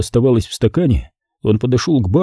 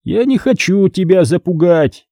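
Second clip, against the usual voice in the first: high, gloating threatening voice; menacing caller; 8 semitones higher than usual. A narrator's reading. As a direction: correct him in a high, malicious voice. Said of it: "В голосе звучала злость". (угрожающе, высоким голосом)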